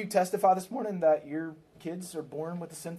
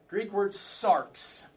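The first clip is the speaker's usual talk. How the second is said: sarx said slowly and clearly as one crisp syllable; slow, deliberate teacher delivery of sarx; slow careful teaching; 3 semitones higher, much slower than usual. Shown as a demonstration. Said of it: "Everybody say sarx".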